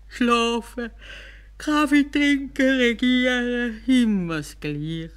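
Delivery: höher, langsam und gedehnt gelangweilt; gelangweilter Kalif. Acted. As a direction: Kalif seufzt vor Langeweile, ganz träge. Gelangweilter Kalif auf dem Sofa. (Kalif auf dem Sofa, gedehnt und gelangweilt)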